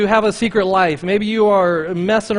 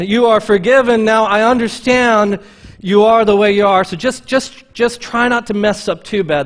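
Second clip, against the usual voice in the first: mildly louder, hammed-up easygoing Jesus; mock lenient Jesus; 4 semitones higher, 6 dB louder than usual. Preacher mocking a lenient Jesus, lightly hammed-up. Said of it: "That's not what Jesus said".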